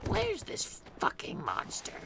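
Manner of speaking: sharp hissed whisper. Acted. Doctor searches the stairs for the monster, whispered. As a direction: hiss it under your breath.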